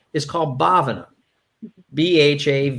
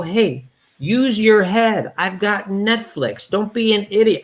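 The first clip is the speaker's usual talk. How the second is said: higher and slow, talking down; imitating the brother lecturing over Netflix; scolding brother; 6 semitones higher than usual. Higher, slow condescending. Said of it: "he'd go".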